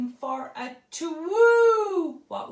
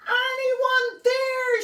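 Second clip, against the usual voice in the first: high, loud and slow anyone there; calling out; 10 semitones higher, 7 dB louder, slower than usual. Acted. Olive calls out hoping her dream owl hears. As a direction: call hopefully into the night. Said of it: "she called out".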